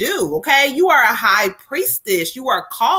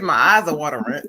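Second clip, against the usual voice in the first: usual speaking voice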